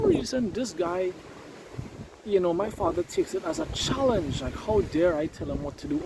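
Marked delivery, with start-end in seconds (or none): none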